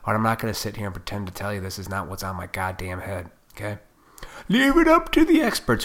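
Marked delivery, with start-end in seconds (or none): 4.5-5.8 high, loud sneering parody of advice; mock posh quote. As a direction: squeal it out like a smug know-it-all.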